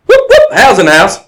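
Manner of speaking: loud, high whoops with a faked Irish lilt; loud mock-Irish hype call; Irish announcer catchphrase. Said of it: "I don't have a good Irish accent".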